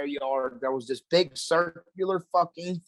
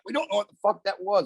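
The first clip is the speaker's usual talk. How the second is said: higher-pitched retold panic; mock a freaked-out neighbor, pitched up; retold freaking out; 5 semitones higher, faster than usual. Neighbors freaking out over what they saw, retold panic. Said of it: "they was freaking out".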